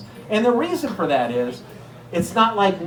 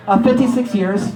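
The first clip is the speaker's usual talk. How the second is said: raised, imitated Italian grandma; Italian-accented grandmother, pitched up; heavy Italian accent; 5 semitones higher, 4 dB louder than usual. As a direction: answer in a thick mock Italian accent. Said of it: "a very heavy Italian accent".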